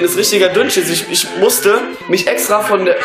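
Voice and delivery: ordinary delivery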